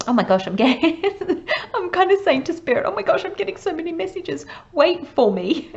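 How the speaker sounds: high, giddy rush breaking into laughter; really excited. Real, not acted. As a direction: gush breathlessly fast, laughing, then stretch the plea out loud. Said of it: "I feel suddenly really excited".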